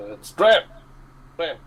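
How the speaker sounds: loud, high gruff shout of a name; gruff it out like a goblin. Acted. Player's disguised goblin answering the boss, loud gruff shout. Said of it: "I'll sort of gruff out".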